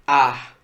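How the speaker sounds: "ar" punched out loud on its own; stressed syllable. Shown as a demonstration. Teacher isolating the tonic syllable, "ar" said loud and firm. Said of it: "como sílaba tônica".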